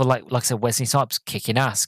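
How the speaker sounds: normal talking voice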